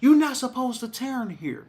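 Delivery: mock scolding shout from the passenger seat; mama yelling. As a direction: play the mother scolding her son at the wheel. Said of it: "my mama yelled at me".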